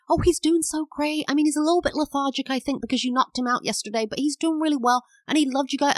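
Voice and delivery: high-pitched, clueless, oblivious chatter; chatty rambling. Retold or imitated. Clueless owner rambling to the vet about the dog, chatty rambling. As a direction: prattle on brightly, high and quick. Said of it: "just A D H D, just got talking".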